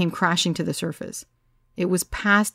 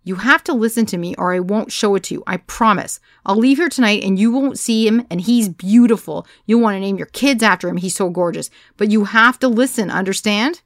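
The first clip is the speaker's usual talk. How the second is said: loud, insistent bargaining; louder pleading over a tipsy crowd; firm; 4 semitones higher, 7 dB louder than usual. A narrator's reading. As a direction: hold them with promises. Pleading threat to rowdy crowd.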